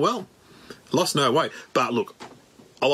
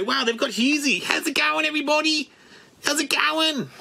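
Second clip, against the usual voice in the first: much higher, sing-song greeting; Husey impression; 11 semitones higher than usual. Comic mimics Husey greeting viewers, much higher voice. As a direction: greet everybody like Husey, pitched way up. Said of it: "I don't know why Husey speaks like that".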